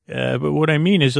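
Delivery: usual speaking voice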